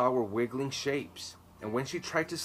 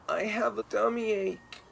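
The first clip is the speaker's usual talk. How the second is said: much higher put-on whiny voice; fake sick whine; 10 semitones higher than usual. A narrator's reading. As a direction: play sick to stay home. Faking illness to mother.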